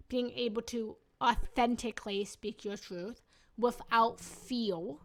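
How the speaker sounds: usual speaking voice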